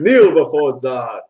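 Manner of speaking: slow, imperious villain decree; dark lord. Retold or imitated. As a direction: command them to kneel, slowly. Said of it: "is that what he said".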